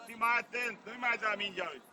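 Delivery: ordinary delivery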